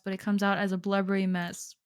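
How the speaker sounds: normal talking voice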